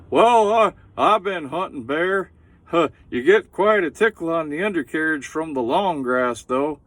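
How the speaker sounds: Hank Hill voice done up big; Hank Hill impression. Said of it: "he says".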